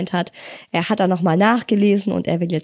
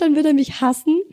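normal talking voice

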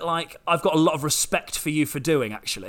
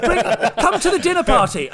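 higher, mock-posh host with laughter; liberal middle-class host; 7 semitones higher than usual. Liberal host inviting a peasant to dinner, mock posh. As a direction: put on a gushing posh invitation. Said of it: "as a liberal middle class person going".

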